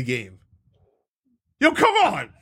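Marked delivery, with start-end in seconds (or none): 1.6-2.3 excited yell. Real, not acted. Excited about the Die Hard map.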